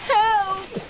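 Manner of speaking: shouting for help; calling for help. Acted. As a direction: call out so someone hears.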